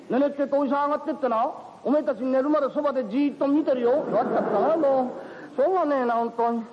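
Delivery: put-on high, chatty old landlord voice; kindly old uncle. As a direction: fuss over the young couple like a doting uncle. Uncle calling up again.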